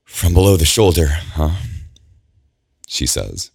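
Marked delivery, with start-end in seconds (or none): 0.1-1.6 gritted teeth. Acted. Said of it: "She grits her teeth".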